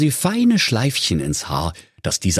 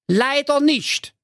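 very high, slow squeaky mother; drawn-out comic mother reply; cartoon mother, pitched way up; Mother Keule; slower than usual. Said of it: "sprach da die Ingeborg Keule".

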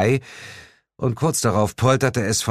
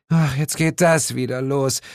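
higher-pitched weary complaint; annoyed groan; 7 semitones higher than usual. A narrator's reading. Sheila groaning at the narrator's announcement, annoyed. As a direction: groan it, thoroughly fed up. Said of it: "murmelte Sheila und stöhnte genervt".

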